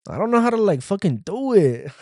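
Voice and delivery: high, loud fake sob voice; mock crying. Podcaster voicing his crying self, high fake sob. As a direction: whine it out like a crying little guy. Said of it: "starts crying".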